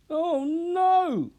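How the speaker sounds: very high, loud cry of horror; panicked shout. Acted. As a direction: scream it out in panic. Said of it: "shouted Antonia".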